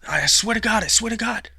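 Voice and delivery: loud, high, pleading oath; desperate swearing. Acted. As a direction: swear it like your life depends on it. Desperate insistence at gunpoint.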